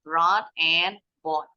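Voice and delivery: Brought with the R, then bought with none, said very slowly; slow contrast demo. Shown as a demonstration. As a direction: set Brought against bought, slowly and clearly. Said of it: "baca yang betul tadi".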